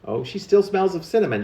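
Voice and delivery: plain reading voice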